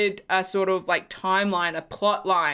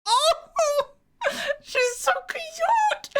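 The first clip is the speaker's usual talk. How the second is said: much higher, slow, near-tears gush; tearful gushing; much slower than usual. Real, not acted. Fan mourning a dead character, voice high and tearful. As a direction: ache over her as you say it. Grieving a killed favourite character.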